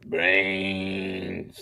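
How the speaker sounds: groaning undead moan; slow moaning zombie. Pretend. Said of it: "Give us your best zombie".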